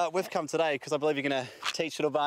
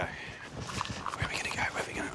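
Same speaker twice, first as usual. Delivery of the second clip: whisper; 6 dB quieter than usual. Real, not acted. Hiding from the dog.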